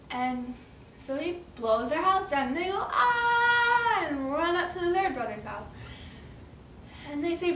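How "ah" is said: long, high storybook scream; loud cartoon shriek of alarm; play-acted scream. Pig flees the blown-down house, high mock scream.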